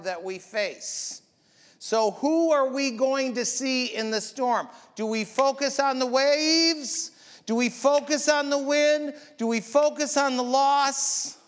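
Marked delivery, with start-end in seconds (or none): 1.8-11.4 higher, louder preaching build; rising preacher's cry; full-force preaching. Acted. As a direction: hammer each question home from the pulpit. Preaches the storm questions.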